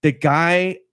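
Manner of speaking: a hint of an old-school announcer; lightly mock announcer. Friend mocks a podcast narrator with a light announcer voice. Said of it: "old school kinda broadcaster announcer".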